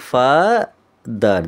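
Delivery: ফাদার with a long ফা, a gap, then দার; split into syllables. Shown as a demonstration. Teacher giving an example of syllables, ফাদার pulled apart in two. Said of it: "দুটো হলো কিন্তু".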